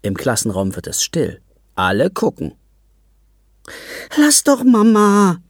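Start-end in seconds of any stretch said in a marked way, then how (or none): 4.2-5.4 put-on high, slow pleading girl's voice; begging child. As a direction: beg Mama to stop, drawn out. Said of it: "bettelt Helene".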